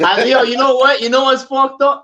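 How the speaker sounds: laughing excited outburst. Real, not acted. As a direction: burst out with delight. Excited reaction to interviewer.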